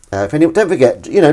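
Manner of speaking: ordinary talk, nothing marked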